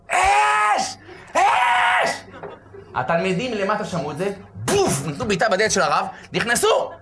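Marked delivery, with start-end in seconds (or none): 0.1-2.2 high, loud cry of alarm; shouting fire. Acted. Rabbi on the stairs crying to his students, high loud shout. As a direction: scream it out for help. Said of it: "הוא התחיל לצעוק".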